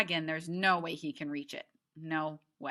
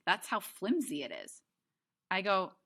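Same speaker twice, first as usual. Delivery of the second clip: plain reading voice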